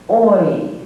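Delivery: ordinary delivery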